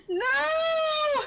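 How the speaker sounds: loud, high cry of dismay; frustrated shout. Real, not acted. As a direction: yell it out in despair. Bad roll while distracted.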